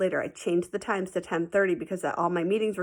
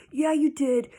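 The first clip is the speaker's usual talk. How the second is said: put-on kid insisting; 8 semitones higher, 5 dB louder, slower than usual. Kids arguing in class.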